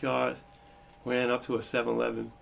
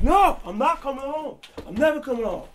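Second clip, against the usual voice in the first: defiant yell; 12 semitones higher, 5 dB louder than usual. Retold or imitated. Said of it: "And I'm like".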